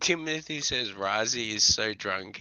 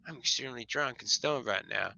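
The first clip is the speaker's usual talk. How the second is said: normal talking voice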